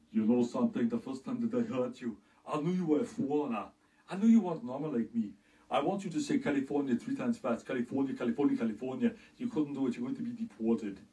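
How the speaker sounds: put-on Austrian accent; Schwarzenegger impression. Austrian bodybuilder mocking someone's accent, in an Austrian accent. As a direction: lean on the heavy Austrian accent. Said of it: "Arnold Schwarzenegger would criticize".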